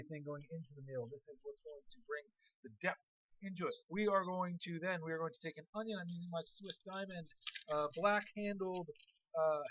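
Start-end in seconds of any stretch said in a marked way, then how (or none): none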